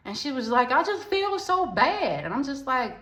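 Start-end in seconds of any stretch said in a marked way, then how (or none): 0.7-2.2 high, slow fake-crying friend imitation; mock tearful whine. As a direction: mimic a friend's put-on sobbing. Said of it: "she just started bawling".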